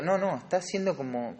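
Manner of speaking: normal talking voice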